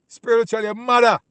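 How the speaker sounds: high, loud mocking snap back; scornful shout. Real, not acted. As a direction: shout it down with contempt. Scornful retort to an imagined Edomite.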